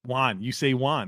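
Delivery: JUAN like wand without the d; imitated mispronunciation. Host mimicking his co-host's WON, imitated mispronunciation as JUAN. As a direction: say Juan with an ah vowel, standing in for won. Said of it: "like you're saying wand without the D".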